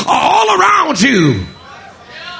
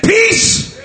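high ringing shout on Peace, then settling; commanding rebuke; 7 semitones higher, much slower than usual. Acted. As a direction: rebuke the storm, stopping it cold. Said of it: "he rebuked the wind".

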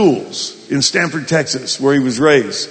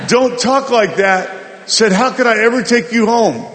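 retold scolding; 7 semitones higher than usual. Husband to cursing Maggie, acted-out scolding. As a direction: scold her in a higher voice, acting it out.

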